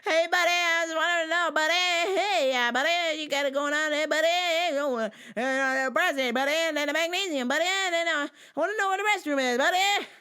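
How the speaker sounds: high, loud goofy buddy voice; annoying customer. Retold or imitated. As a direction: chirp it like an overeager regular. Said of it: "just will not stop with the buddy".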